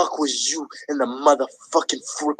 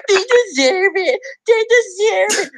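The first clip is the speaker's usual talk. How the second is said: anguished high yell; 3 dB louder than usual. Real, not acted. Heartbroken Warriors fan wailing about rivals winning. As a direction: voice cracking high and loud.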